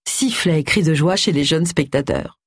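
ordinary delivery, nothing marked

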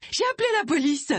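high urgent shout; calling out. A narrator's reading. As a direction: shout the confession through the door. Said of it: "lança Agatha".

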